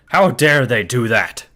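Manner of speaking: put-on slow, loud, outraged old man; angry old man. An elder outraged at the desecration, shouting slowly. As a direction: bellow it like an outraged village elder. Elderly men yell in anger.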